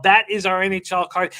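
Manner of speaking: ordinary delivery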